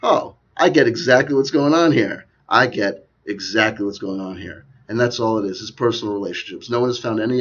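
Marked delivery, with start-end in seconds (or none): 0.0-2.2 louder, higher dawning insight; acted realization. Talker voicing a viewer's thought, loud sudden realization. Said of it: "you could just say to yourself". 2.5-4.6 slow, measured restatement; deliberate realization. Acted. Talker repeating the viewer's thought, slow and deliberate. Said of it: "you could just say to yourself".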